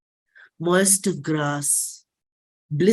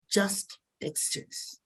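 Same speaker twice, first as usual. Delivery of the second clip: a slow breathy whisper, softer than the line before; 10 dB quieter, slower than usual. Acted. Poet to the audience, a hushed whisper. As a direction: let the words fall to a whisper.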